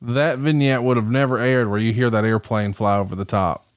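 ordinary talk, nothing marked

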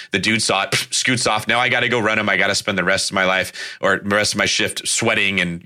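usual speaking voice

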